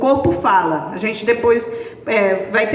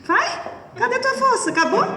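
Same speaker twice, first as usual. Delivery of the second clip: teasing challenge; 11 semitones higher than usual. Acted. Provoking partner in force exercise.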